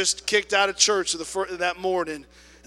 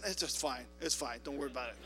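much softer, lower, placating; meek backing down; 4 semitones lower, 12 dB quieter, faster than usual. Retold or imitated. Attendant meekly soothes the angry lady. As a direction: shrink away and smooth it over.

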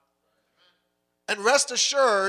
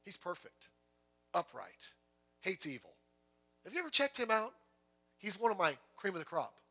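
soft, hushed, casual boasting; God bragging softly; 9 dB quieter than usual. Acted. God quietly bragging to Satan about Job, soft and casual. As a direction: murmur it like a proud friend sharing a secret. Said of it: "And God goes".

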